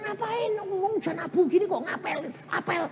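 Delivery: very high, disbelieving question; incredulous. Retold or imitated. Pastor quizzes young man. (bertanya heran dengan nada tinggi)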